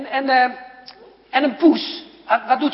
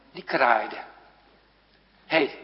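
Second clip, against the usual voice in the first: ordinary talk, nothing marked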